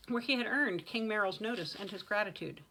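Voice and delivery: ordinary delivery, nothing marked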